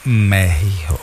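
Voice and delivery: México in a mock American accent; mocking Trump's Mexico. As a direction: echo the gringo Mehiho. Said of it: "And they didn't know".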